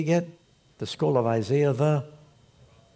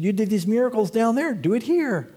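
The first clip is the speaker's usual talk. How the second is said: higher, louder taunt; taunting demand; 8 semitones higher, 3 dB louder than usual. Retold or imitated. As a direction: dare him to prove it. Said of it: "They said".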